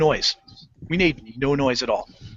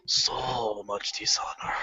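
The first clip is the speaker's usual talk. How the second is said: slow, low whispered so much dishonor; ghostly whisper; 6 semitones lower, 11 dB quieter, much slower than usual. Retold or imitated. Scolding himself after getting caught, slow whisper. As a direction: breathe it out like a ghost. Self-reproach aside.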